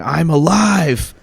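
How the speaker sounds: mild imitation of a cathartic scream; retold scream. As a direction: stretch it out like a man shouting to the sky. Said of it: "he was up there screaming".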